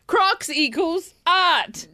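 slow, separated phrases with dramatic pauses; slam poetry. Acted. Host apologising to Crocs in verse, slam poetry. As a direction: declaim each fragment like profound verse. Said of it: "so it's in that style".

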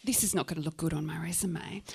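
low, whispered dry aside; hushed private thought. Acted. Youth worker thinking of her job, hushed private thought. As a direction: murmur it to yourself.

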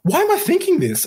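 high-pitched incredulous question; squeaked, astonished self-questioning; sudden disbelief. Retold or imitated. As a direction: leap way up in baffled realisation. Retold sudden realisation after panic.

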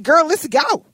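loud, high, eager yes; eager agreement. Retold or imitated. Coworker agreeing to the scheme, loud and eager. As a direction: jump at the plan.

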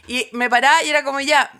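normal talking voice

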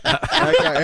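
laughing it out in disbelief. Real, not acted. Laughing about Crocodile Dundee.

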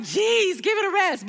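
teasing annoyed mother-in-law; mock exasperation. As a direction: roll your eyes at the couple. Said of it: "I'm like".

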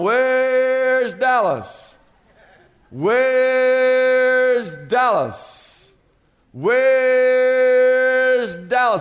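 slow, drawn-out sing-song calling, far-off timbre; playful seeking call. Retold or imitated. Dad calling through the house for his hiding son, slow drawn-out playful calls. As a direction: stretch each call out like a dad pretending to search.

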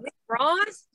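high, incredulous echo of the words; shocked question. Retold or imitated. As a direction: repeat it in pure disbelief. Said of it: "I go".

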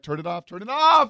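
mimicking someone begging for it to stop; retold urgent demand. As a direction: build to a mock yell on the last OFF. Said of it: "before she's like".

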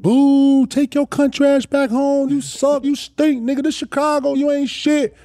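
high, jeering catcalls; retold crowd heckling. Hostile crowd heckling a visiting player.

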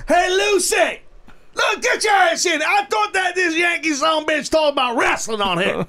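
high, hollered mock Texas accent; wandering Texas accent at a shouting pitch. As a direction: rant like a hammed-up Texan. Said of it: "My Texas accent rolls into all of those accents".

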